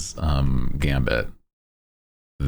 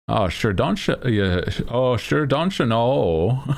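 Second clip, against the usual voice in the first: higher, hammed-up Midwest-Canadian accent; mock Upper Midwest accent, breaking into laughter; northern Midwestern accent; 8 semitones higher than usual. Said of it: "Midwesterns. It's just more common with more, more north you go".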